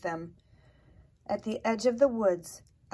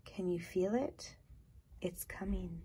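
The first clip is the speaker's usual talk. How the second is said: whisper; 8 dB quieter than usual. A narrator's reading. Raccoon's heart song whispered.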